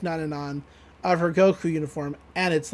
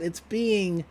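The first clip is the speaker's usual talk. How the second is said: normal talking voice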